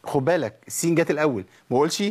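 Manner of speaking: ordinary delivery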